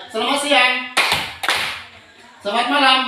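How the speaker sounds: high, loud classroom cues; called greetings. Shown as a demonstration. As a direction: call each greeting out to the class. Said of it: "Kalau selamat siang". (salam diserukan keras kepada kelas)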